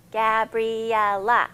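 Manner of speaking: Gabriella slowed into four beats Ga-bri-el-la; syllables clapped out. Shown as a demonstration. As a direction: clap each beat of Gabriella slowly. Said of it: "So there's four".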